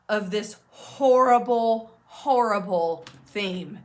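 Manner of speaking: "not" and "horrible" punched, with pauses between phrases; slow, deliberate weight on "not" and "horrible"; emphatic reassurance. Real, not acted. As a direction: drive home "not" and "horrible", slow and firm. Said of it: "I'm emphasizing this in this way".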